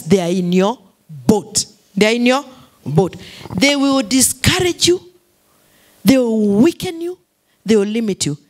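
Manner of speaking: ordinary talk, nothing marked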